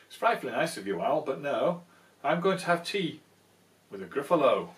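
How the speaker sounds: fast, loud, chirpy little mouse; quick plucky mouse; brisk mouse. Acted. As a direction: cheekily turn him down. Mouse refuses the owl.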